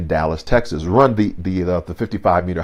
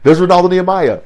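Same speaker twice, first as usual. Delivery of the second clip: usual speaking voice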